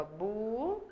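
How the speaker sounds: "bu" held long as a lone syllable; sílaba isolada. Shown as a demonstration. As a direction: stretch out "bu" by itself. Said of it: "eu separo a sílaba". ("bu" isolado e alongado)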